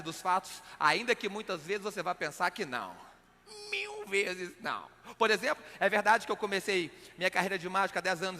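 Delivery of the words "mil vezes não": high, slow soft melodramatic no; milk it, high and slow; dramatic denial. Acted.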